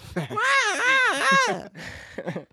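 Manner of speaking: high squealing laugh like a dying bird. Real, not acted. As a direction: let out a strangled squeaky laugh. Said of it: "Why'd you sound like a dying bird".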